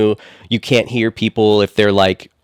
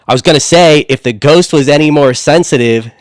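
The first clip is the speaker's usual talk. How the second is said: loud call-out; 5 semitones higher, 4 dB louder than usual. Retold or imitated. Walks back up to brother.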